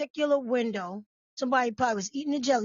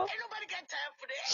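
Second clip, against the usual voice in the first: mock dismissive quote; 8 semitones higher, 11 dB quieter, faster than usual. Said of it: "She said".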